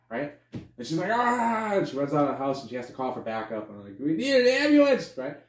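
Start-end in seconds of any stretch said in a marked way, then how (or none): 1.1-1.7 high, drawn-out imitated scream; mock scream. Officer finding the rotting husband, mock scream. 4.0-5.1 high, loud mock-hysterical cry; panicked yell. Officer calling for backup, high mock-panicked yell.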